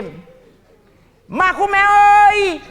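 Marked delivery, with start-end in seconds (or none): 1.3-2.6 loud, high, long-drawn holler; calling out. Acted. Drunk Tony hailing Makume at two in the morning, hollering. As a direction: holler it across the yard, stretching the last vowel.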